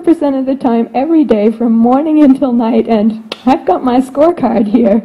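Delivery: fast, mock-accusing teenager reading a scorecard; whiny teen complaint. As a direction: rattle off the complaint like an aggrieved teen.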